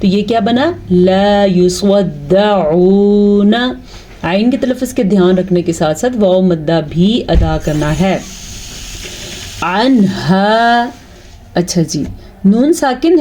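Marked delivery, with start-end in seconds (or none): 0.9-3.5 لا یصدعون with the واو long, slowly recited; stretched waw. Shown as a demonstration. Teacher demonstrates the long waw, slowly recited. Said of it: "اس کو ہم کھینچ کے پڑھیں گے". 9.6-10.9 عنہا with the نون said clearly, no nasal hold; clear noon. Shown as a demonstration. Teacher demonstrates izhar on the noon, slowly. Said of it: "ظاہر کر کے پڑھتے ہیں".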